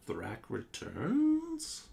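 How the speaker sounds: a dazed dead head mumbling its phrase; mumbling moaning head; mumbled head voice. A narrator's reading. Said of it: "it mumbles".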